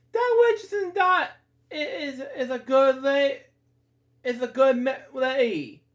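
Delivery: high strained upset plea; upset protest. Real, not acted. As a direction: protest that she's a good lady. Upset defending the witch.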